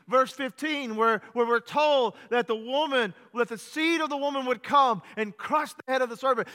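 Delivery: loud, driving pulpit preaching; fiery preaching. Acted. Preacher telling the congregation of the angel, fiery preaching. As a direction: preach it out to the whole church.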